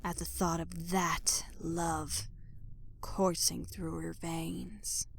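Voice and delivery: slow, soft whisper; linger, almost salivating; hushed craving. A narrator's reading.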